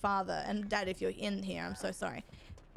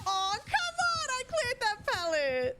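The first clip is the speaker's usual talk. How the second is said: gamer frustration; 12 semitones higher than usual. Real, not acted. Streamer protesting to the game, high outraged cry. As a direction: yell it at the screen.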